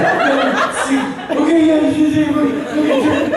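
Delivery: very high, loud panicky babble; hammed-up squealing panic; mock frantic. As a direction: act a friend frantically following phone instructions. Panicking friend acted on phone.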